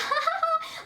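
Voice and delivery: very high mock giggle; imitated laughing. Date takes the insult as a joke, high imitated laugh. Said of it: "just laughing at it".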